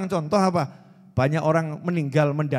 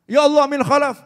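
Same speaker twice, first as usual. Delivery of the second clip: loud, higher cry to Allah; shouting prayer; 8 semitones higher, 7 dB louder than usual. Retold or imitated. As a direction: call out ya Allah at full voice. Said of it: "Sampai teriak".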